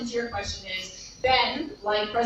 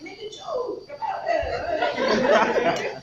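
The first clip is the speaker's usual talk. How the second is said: high, slow laughing suggestion; 10 semitones higher, much slower than usual. Retold or imitated. Teasing Ben about telling the president, laughing. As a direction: giggle the advice.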